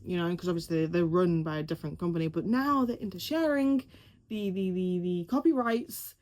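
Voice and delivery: ordinary talk, nothing marked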